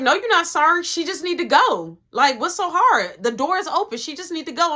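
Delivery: heated retold telling-off; imitated angry girlfriend. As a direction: act out impatiently ordering someone out. Girlfriend angrily orders other out.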